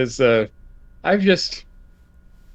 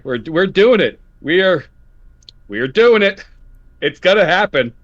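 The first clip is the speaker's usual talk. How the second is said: louder and higher, insisting it will happen; rally everyone, it's really coming; emphatic promise; 6 semitones higher, 5 dB louder than usual. Real, not acted. Host vowing the delayed podcast returns, loud and insistent.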